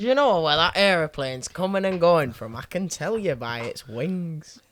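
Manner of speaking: mock Yorkshire plane-spotter voice, slow at the end; Yorkshire accent. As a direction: take the mick in a thick Yorkshire accent. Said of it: "'cause that's how I talk".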